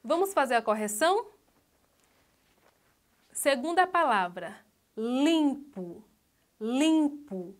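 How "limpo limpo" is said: "limpo" twice, LIM held long; stress on LIM. Shown as a demonstration. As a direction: sound out "limpo", leaning on LIM. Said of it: "A sílaba tônica é". ("limpo" alongando a sílaba LIM)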